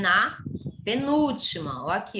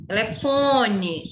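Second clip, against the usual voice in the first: "Telefone" with the nasal FON held long; slow demo, long stressed FON in "Telefone"; lingering on FON; much slower than usual. Shown as a demonstration. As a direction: stretch the third syllable of "Telefone". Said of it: "ele demora".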